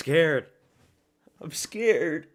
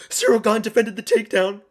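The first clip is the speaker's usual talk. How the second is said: excited call; 6 semitones higher, 9 dB louder, faster than usual. Real, not acted. Takedown defended live.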